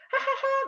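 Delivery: high-pitched imitated giggle; mock child's laugh. Dad retelling his daughter's gleeful reaction, mock laughing. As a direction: laugh out "hahaha" like a gleeful kid. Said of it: "막 웃으면서".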